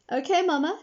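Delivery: put-on high child's voice; obedient girl. A narrator's reading. Jamila agrees to go to Thelma's, obedient girl. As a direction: answer mama in a high little voice. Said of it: "said Jamila".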